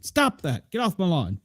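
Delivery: put-on cranky geezer shooing kids away; old man voice. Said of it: "I sound like an old man".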